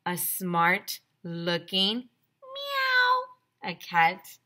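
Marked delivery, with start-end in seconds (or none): 2.4-3.7 high, drawn-out imitated meow; cat meow. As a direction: mew like a cat.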